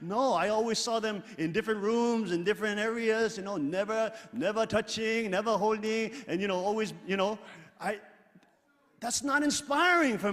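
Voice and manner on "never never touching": ordinary talk, nothing marked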